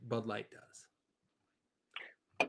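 slow breathy whisper. Real, not acted. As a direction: whisper it aside. Aside whisper.

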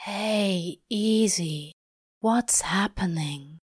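lightly voiced Crowley, hushed and slow; Crowley, soothing. A narrator's reading. Crowley calming the sobbing angel, soothing. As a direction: ease a weeping friend down gently.

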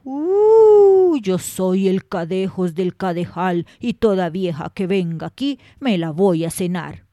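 put-on loom over the old woman as a terrifying beast; horrible monster voice. A narrator's reading. The Cadejos threatens the old woman in her bed, booming monster voice. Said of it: "oyó una voz horrorosa".

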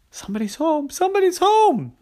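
high, rising frightened cry; panicked. Acted. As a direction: panic as they arrive. Ollie panics at footsteps.

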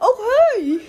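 surprised. Acted. Mock surprise.

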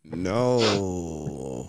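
low, soft drawn-out refusal; mock stern dad through still lips; ventriloquist dad.

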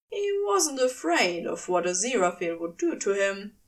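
ordinary delivery

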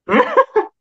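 bursting high-pitched laugh. Real, not acted. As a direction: laugh out at your own joke. Laughs at own joke.

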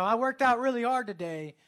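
acting out someone justifying a treat; self-justifying. Acts out self-justifying gym-goer.

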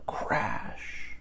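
soft, drawn-out whispered sound word; whispered crash. A narrator's reading. Sound word of the falling tablecloth.

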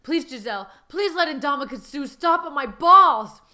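loud, building mock plea; beg, stretching the words out; hammed-up begging; pleading husband. Husband begs wife in story.

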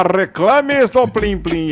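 announcer call. Acted. Announcer-style call, slowed.

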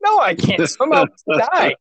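high-pitched comic blurting; hammed-up confession of helplessness; mock desperate admission. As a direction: blurt it high, close to laughter. Acted blurted admission to rescuer.

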